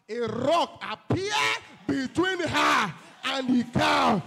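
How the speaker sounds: loud, slow, booming climax of the story; preaching shout. Acted. Preacher to congregation, telling the dream at a booming shout. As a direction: thunder it out to the congregation.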